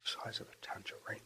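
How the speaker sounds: faint breathy whisper of the line; whispered echo. Pretend. Echoing Alfred line whispered.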